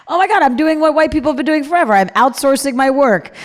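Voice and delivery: catching herself mid-thought; a real jolt of realization; sudden realization. Real, not acted. Sudden self-realization, exclaimed.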